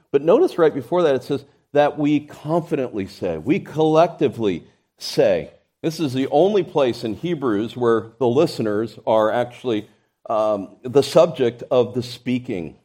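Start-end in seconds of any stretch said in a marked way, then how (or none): none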